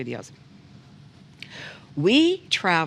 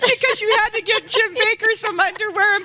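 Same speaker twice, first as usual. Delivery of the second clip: teasing excitement; 4 dB louder than usual. Real, not acted. Teasing guest about shopping.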